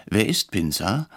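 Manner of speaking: ordinary delivery, nothing marked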